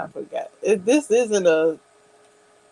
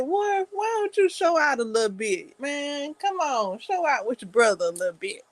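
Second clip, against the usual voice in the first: egging him on; 7 semitones higher than usual. Retold or imitated. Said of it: "I'm like".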